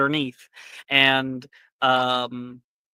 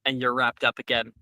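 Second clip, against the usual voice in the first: normal talking voice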